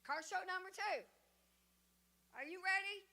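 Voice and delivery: ordinary delivery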